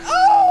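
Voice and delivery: very high, loud shriek; jump-scare squeal; startled scream. Real, not acted. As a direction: cry out in sudden shock. Game scare.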